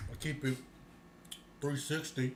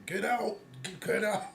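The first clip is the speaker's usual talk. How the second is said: higher, louder hammed-up shooing; mock get out; 8 semitones higher, 7 dB louder than usual. Mocking a loner who invites then evicts, loud mock shooing. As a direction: bark them back out the door, half-joking.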